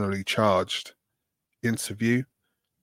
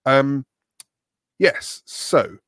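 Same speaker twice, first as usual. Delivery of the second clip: radio voice; 3 semitones higher, 4 dB louder, slower than usual. Pretend. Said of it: "And the radio voice".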